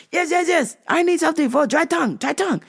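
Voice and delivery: earnest lady with a strange request; lightly accented old lady; foreign lady voice. Retold or imitated. Lady insisting.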